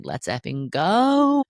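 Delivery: drawn-out hyped cheer; excited cheer. Retold or imitated. Inner child cheering at a bold move, slow and hyped. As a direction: let it rip like a thrilled kid.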